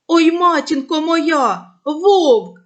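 slow, loud, high cry of fright; frightened cry. Acted. Boy seeing a real wolf, loud and high frightened cry. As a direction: cry out in sudden terror at the wolf. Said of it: "вигукнув хлопець".

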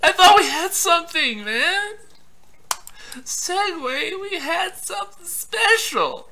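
high, soft jilted-lover whine; mock heartbreak. Player to departed Segway, mock-heartbroken plea. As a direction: plead in hammed-up heartbreak, trailing slowly.

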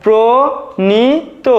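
প্রণীত drawn out very slowly as "pro-ni-to"; slow model word. Shown as a demonstration. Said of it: "সঠিক উচ্চারণ হবে দেখো".

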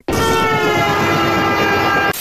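sudden very high squeak; very high squeaky interjection. Acted. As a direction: pop in with a tiny high voice.

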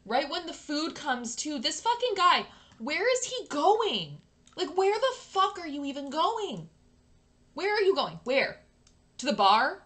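loud, high-pitched exasperated outburst; outraged yelling. Real, not acted. Player yelling at the date walking off, outraged. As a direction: yell after him in disbelief.

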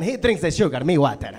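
ordinary talk

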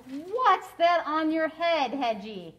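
slow mock-surprised hen; mocking mother hen. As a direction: poke fun at him like a hen. Hen mocks Hedgie.

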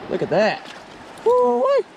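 ordinary talk, nothing marked

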